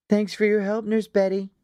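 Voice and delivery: a light thank-you from a schoolgirl; Snowflake. A narrator's reading. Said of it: "Snowflake just smiled and said".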